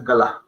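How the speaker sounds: "galá" with both vowels sounded; two clear syllables in "galá", stress last; different word. Shown as a demonstration. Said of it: "una palabra totalmente diferente".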